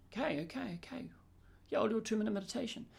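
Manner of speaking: breathy whisper, agreeing with yourself; a client talking himself into it under his breath; whispered agreement. Acted. Client quietly agreeing to the coach's meditation idea, whispered.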